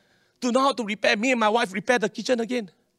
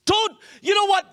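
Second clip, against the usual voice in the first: preaching shout; 9 semitones higher, 3 dB louder than usual. Real, not acted. Preacher exclaiming to crowd.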